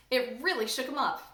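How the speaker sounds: usual speaking voice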